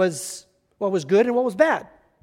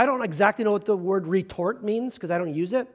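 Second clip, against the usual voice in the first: ordinary delivery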